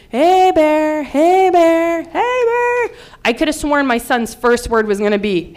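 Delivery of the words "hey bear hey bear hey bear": high, drawn-out warning call, climbing each time; mock yell, pitched higher with each repeat; retold screaming. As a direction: call out to scare off a bear. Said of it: "I'm screaming".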